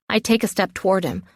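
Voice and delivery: ordinary delivery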